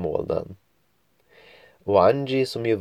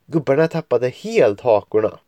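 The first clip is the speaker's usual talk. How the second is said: plain reading voice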